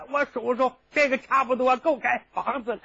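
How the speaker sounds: usual speaking voice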